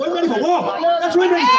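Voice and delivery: ready for war, yelled; defiant shout. Real, not acted. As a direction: shout it out to rally them. Defiant cry to the crowd.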